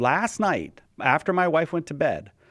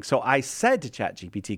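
ordinary talk, nothing marked

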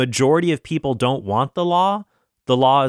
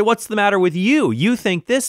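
higher-pitched imitation of indignation; mock indignant challenge; 7 semitones higher than usual. Those who disagree answering back, mock indignant. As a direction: challenge them in a raised, mock-affronted voice. Acts out opponents' challenge, accent dropped.

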